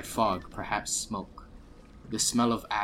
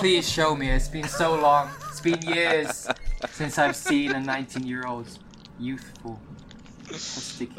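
hammy stage actor; 4 dB louder than usual. Acted. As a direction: beg like a hammy old actor. Dwarf begs in a hammy voice.

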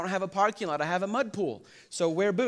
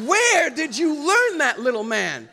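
slow, higher, louder mock reproach to a toddler; mock scolding dad; 8 semitones higher, 8 dB louder, slower than usual. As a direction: drag it out like teasing a little boy. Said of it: "I'm like".

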